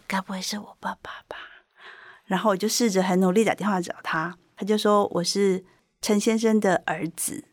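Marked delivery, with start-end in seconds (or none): 0.1-1.3 hushed, near-whispered worried thought; inwardly torn. Retold or imitated. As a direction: think aloud softly, with a knot inside. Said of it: "我那时候心里一纠结说".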